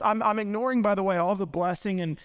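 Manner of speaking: usual speaking voice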